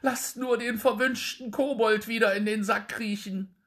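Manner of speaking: hoch und laut, flehend; kleinlaut gerufen. Acted. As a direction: bettle hastig um Gnade. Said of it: "rief der Wirt ganz kleinlaut". (der geschlagene Wirt fleht den Drechsler hoch und verängstigt an)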